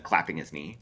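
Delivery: usual speaking voice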